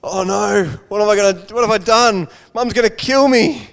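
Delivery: put-on a kid realising he's in trouble; boy panicking over the broken vase; panicked boy. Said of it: "the boy's just like".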